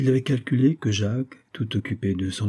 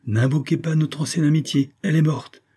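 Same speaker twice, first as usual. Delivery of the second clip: higher-pitched, haughty rebuff; 7 semitones higher than usual. A narrator's reading. Jacques rebuffing his old friend, haughty. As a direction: reject him from on high. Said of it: "d'un air hautain".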